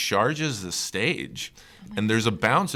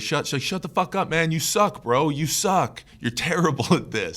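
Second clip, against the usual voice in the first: raised-pitch mock tirade; imitating pissed-off heckler; 8 semitones higher than usual. Comic retelling a New York heckler yelling at the stage, mock pissed. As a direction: hurl insults as if from the crowd. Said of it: "getting really pissed".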